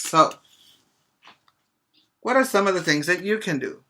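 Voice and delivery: normal talking voice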